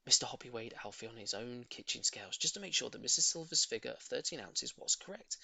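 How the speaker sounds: plain reading voice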